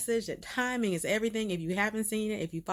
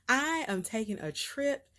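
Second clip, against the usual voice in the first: ordinary delivery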